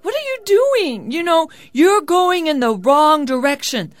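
high, loud hail across the water; calling out. A narrator's reading. Labai calls to people in a passing boat, calling out. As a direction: call out to passers-by in surprise. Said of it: "So Labai called".

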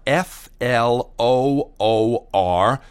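letter by letter, slowly; slow spelling. A narrator's reading. Spelling words to Cinderella.

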